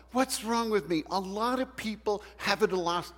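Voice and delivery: high-pitched mock self-reproach. Someone guilty about being sad, acted high-pitched self-reproach. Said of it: "have you ever said this to yourself".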